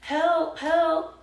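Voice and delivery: high, loud mock cry for help; retold scream, pitched well up; imitated screaming cry. Brother's girlfriend crying out to the neighbors, imitated screaming. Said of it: "screaming and crying".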